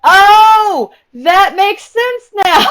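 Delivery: slow, high, loud mock realization; dawning realization. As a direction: imitate him slowly getting it. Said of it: "He was like".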